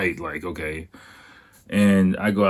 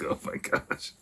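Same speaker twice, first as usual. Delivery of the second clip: softer exhausted sigh of words; weary whisper; 9 dB quieter than usual. Retold or imitated. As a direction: breathe it out, worn out. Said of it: "It was just like".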